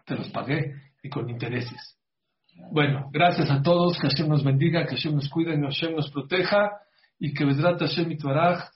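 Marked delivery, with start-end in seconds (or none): 2.7-8.7 louder, rising blessing cadence; closing blessing. Real, not acted. Rabbi closing his talk, blessing everyone with rising fervor. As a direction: bless the audience with uplift.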